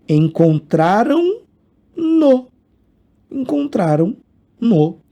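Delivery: teacher models "no" after the nasal; slow demo. Shown as a demonstration. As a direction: draw out "Encontraram no" for the class. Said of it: "É só acrescentar o N".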